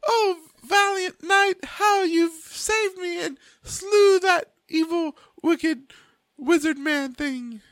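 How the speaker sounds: high, slow swooning maiden voice; damsel falsetto. Pretend. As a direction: swoon like a fairytale princess, squealing. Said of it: "I love your voice".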